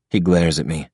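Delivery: ordinary delivery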